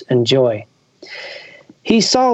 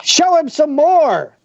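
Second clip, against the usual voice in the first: high, slow, otherworldly goblin king; drawn-out commanding goblin voice; 13 semitones higher than usual. Acted. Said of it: "said the King of Goblins".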